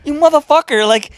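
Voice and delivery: high, loud indignant mother, half amused; mock furious scolding with a laugh creeping in; outraged mom. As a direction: imitate a mom berating her son. Retold mom berating him for lying.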